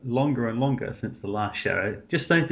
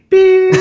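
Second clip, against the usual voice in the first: sharp high loud beep noise; imitated censor beep; 15 dB louder than usual. Mocking a TV censor, a loud high beep. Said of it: "It's like".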